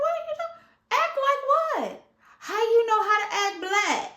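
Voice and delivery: high loud incredulous question; mock outraged squeal; indignant disbelief. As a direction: demand an explanation, stunned.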